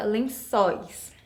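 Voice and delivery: "lençóis" stressed on an open -çóis; open ó sound. Shown as a demonstration. Teacher confirms the finished word, "lençóis" with open stressed ó. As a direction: say "lençóis" as len-SÓIS. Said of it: "ele tá com som aberto".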